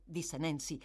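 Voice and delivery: ordinary delivery, nothing marked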